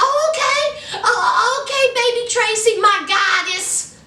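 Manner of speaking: high, mock-adoring husband voice; Clint. Husband giving in to his goddess wife, mock adoring. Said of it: "Clint said".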